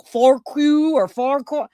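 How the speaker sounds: Farquhar two ways, "koo" then "kaw" ending; guessing at pronunciation. Shown as a demonstration. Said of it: "I don't even know how to say that".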